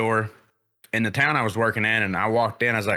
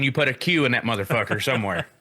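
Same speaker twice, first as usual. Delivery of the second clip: usual speaking voice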